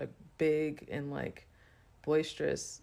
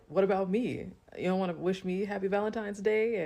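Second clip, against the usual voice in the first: higher, louder mock demand from the friend; loud jealous friend; 5 semitones higher, 5 dB louder than usual. Friend at the bar cuts in on the compliment, loud and indignant. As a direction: play the friend butting in loudly, wanting attention. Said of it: "my friend is, like, loud".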